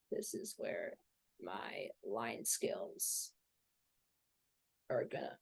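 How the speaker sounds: whispered aside. Real, not acted. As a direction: whisper it under your breath. Self-deprecating aside, whispered.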